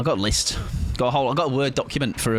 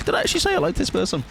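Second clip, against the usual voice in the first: doubtful self-quote; much faster than usual. Retold or imitated. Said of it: "then be like".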